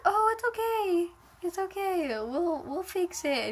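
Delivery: high, soft, slow comforting coo; soothing mom. Retold or imitated. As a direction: imitate a mother soothing a hurt child. Mom comforting her, acted.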